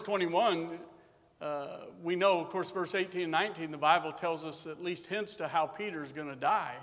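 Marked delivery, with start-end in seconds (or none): none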